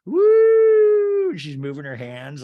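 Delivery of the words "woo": very high, loud imitated whoop; yelled woo. Meredith as fun police trying to distract, yelled woo. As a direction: let out a long, piercing party whoop. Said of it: "is yelling".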